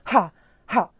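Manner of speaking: loud, explosive HA, HA from the chest; forceful bursts of air on "Ha, ha"; exaggerated aspirate. Shown as a demonstration. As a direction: overdo the breathy H on each "Ha". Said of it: "I exaggerate now".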